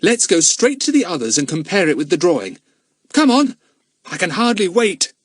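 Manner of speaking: put-on high, loud eager boy; excited schoolboy rush; getting excited. A narrator's reading. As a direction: urge the others on, bursting to go. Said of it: "getting excited".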